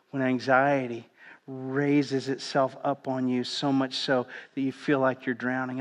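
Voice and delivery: ordinary talk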